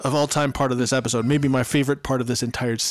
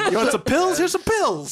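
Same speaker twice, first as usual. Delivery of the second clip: high, laughing sing-song offer; mock pill pusher; 11 semitones higher than usual. Joking as Zach handing Jesse pills, high and laughing. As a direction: offer it up high like a sleazy dealer.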